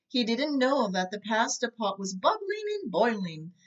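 ordinary delivery, nothing marked

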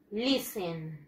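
normal talking voice

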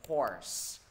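HORSE ending in a long voiceless hiss; final s. Shown as a demonstration. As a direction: draw out the s at the end of HORSE. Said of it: "sẽ đọc là s".

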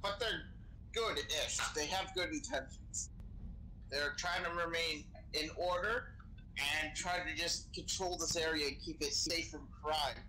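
ordinary talk, nothing marked

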